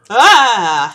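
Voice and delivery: loud sudden shout of fright; real startled yell. Real, not acted. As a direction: yelp as the zombie appears. Zombie appears.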